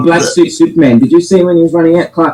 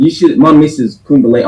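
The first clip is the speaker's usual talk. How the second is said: ordinary delivery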